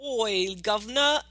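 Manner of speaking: mock Cockney street greeting; bad Cockney-Australian accent. Podcaster demonstrating the villain's accent, goofy mock Cockney. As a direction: play up a dodgy Cockney-Australian twang. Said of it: "halfway between a Cockney accent and a bad Australian accent".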